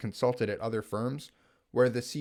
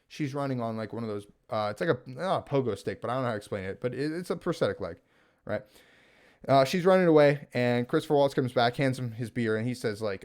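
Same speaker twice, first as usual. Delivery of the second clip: normal talking voice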